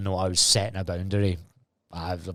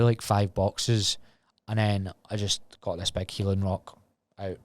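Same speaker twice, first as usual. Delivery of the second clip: ordinary delivery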